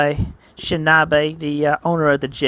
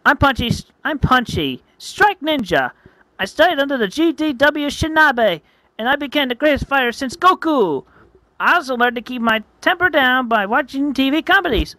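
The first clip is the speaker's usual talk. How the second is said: high-pitched cartoon ninja; boastful Punchy; 8 semitones higher, 3 dB louder than usual. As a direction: proudly introduce yourself in a high, energetic voice.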